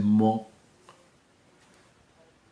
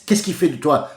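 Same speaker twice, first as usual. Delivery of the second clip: ordinary talk, nothing marked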